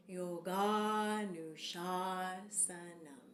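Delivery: Yoganushasanam drawn out very slowly, open ah sounds; slow demo. Shown as a demonstration. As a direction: spell Yoganushasanam out slowly with a dropped jaw on each ah. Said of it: "One more time".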